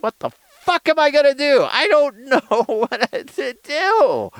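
high, loud mock-desperate wail; hammed-up parent at wit's end; trying not to cry. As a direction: wail it helplessly, stretching the last words. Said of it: "trying not to cry".

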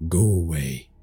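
plain reading voice

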